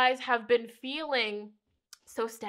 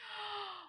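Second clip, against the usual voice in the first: soft gasp; 10 semitones higher, 12 dB quieter than usual. Real, not acted. Reacting to card meaning.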